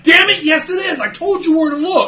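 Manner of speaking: high, loud angry shout; pissed-off mom. Retold or imitated. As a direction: imitate an exasperated mom yelling at her kid. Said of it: "I'm getting pissed".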